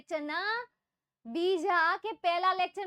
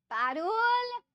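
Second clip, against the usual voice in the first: calling out; 7 semitones higher, 7 dB louder, much slower than usual. Real, not acted. Teacher calling out to student Parul, loud call. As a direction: call the name out loud and high.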